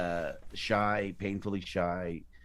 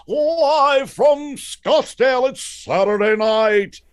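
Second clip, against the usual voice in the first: booming, drawn-out announcer call, much higher and louder; Don Pardo; 14 dB louder, much slower than usual. Shown as a demonstration. Opening a mock Saturday Night Live commercial, booming announcer. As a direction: stretch every word like a big TV show opener. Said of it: "I can only do one announcer, and that's, uh, Don Pardo".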